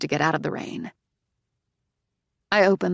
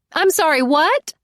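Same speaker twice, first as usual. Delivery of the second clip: high, loud call over the rain; 11 semitones higher, 7 dB louder than usual. Acted. Driver asks narrator to repeat, calling out loud and high. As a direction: shout to be heard through the downpour. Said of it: "she calls out".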